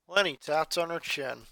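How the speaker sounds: ordinary delivery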